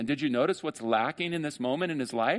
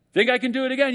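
higher, louder tightrope walker teasing the crowd; showman's call; 11 semitones higher, 6 dB louder, faster than usual. Retold or imitated. Acrobat teasing the crowd before the wheelbarrow, higher and louder.

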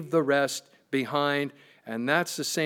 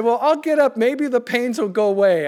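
much higher, louder voice; imitated wife; 7 semitones higher, 7 dB louder than usual. Wife telling husband pains may pass, imitated high voice. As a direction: put on a wife's higher voice. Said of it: "And she said".

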